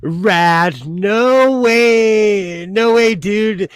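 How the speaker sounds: loud, drawn-out stoked-dude voice; hometown slang impression. Showing viewers how people talk back home, hammed-up amazement. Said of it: "talk like that".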